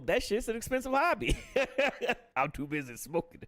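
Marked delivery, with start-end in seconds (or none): 0.1-2.4 laughing. Real, not acted. Joking about smoking costs.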